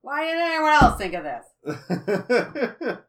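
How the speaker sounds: laughing. Real, not acted.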